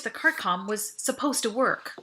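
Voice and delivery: ordinary delivery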